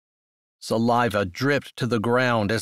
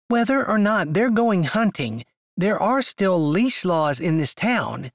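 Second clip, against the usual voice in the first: condescending; 7 semitones higher than usual. Acted. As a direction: lecture him from above. Avery tries condescending.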